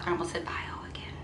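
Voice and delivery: hushed aside to herself; whisper. Real, not acted. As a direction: whisper it under your breath.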